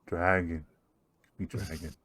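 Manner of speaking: low, soft, intimate murmur; creepy quiet voice. Acted. Strange elf admires the player's cloak in a low, soft voice. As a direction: creep closer with a hushed voice.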